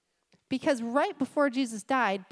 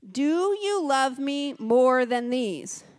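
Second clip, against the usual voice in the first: slow earnest question; 4 dB louder, much slower than usual. Acted. Jesus's question read slowly.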